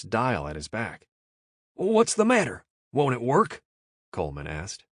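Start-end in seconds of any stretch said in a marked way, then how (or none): 1.7-3.6 high, loud anxious question; nervous Yank. A narrator's reading. Scrawny Yank asking about the nitro, high nervous question. Coleman asks.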